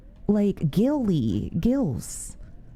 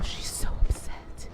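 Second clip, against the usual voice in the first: whisper; slower than usual. Real, not acted. Hiding from angry android.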